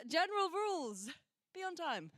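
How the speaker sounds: usual speaking voice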